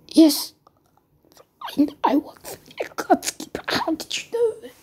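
high, strangled, halting confession; choked. Acted. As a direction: force it out through a squeezed throat. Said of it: "I'm choking myself while doing this".

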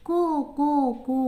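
high rooster crows, koo koo; rooster call. Shown as a demonstration. Teacher answering how the rooster crowed, high crowing. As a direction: crow high like a cock. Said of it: "എങ്ങനെ കൂവിയത്?".

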